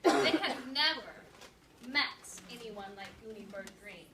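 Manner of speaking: lively read-aloud narration for a class; story read-aloud. Acted. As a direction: read the chapter to second graders with energy.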